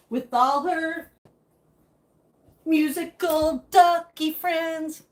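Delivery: slow, sing-song show intro; drawn-out sing-song show promo; promo announcer. Acted. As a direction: announce it like a kids' show host.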